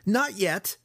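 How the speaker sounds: high, tense snap back; mild growl of defiance; growled retort. A narrator's reading. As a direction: cut back at him angrily. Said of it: "Alton growled".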